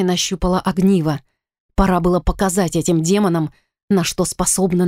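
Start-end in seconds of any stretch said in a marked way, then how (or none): none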